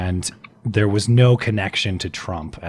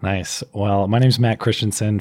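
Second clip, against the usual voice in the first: ordinary talk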